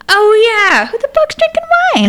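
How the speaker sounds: pretending to be shocked by the wine; mock outrage. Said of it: "And I went".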